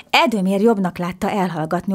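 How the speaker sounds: ordinary delivery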